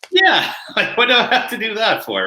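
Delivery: laughing. Real, not acted. Mocking his own pompous past.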